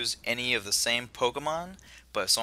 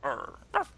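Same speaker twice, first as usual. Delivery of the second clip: soft growly Rockruff bark; dog growl; 5 dB quieter than usual. Acted. Host voices Rockruff answering the professor, growly bark. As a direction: growl and yap like a small dog.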